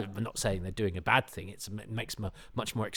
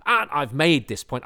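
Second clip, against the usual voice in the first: emphatic self-quote; 6 semitones higher, 10 dB louder than usual. Retold or imitated. Quotes own annoyed written argument.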